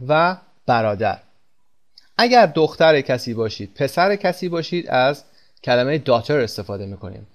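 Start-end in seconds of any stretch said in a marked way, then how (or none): none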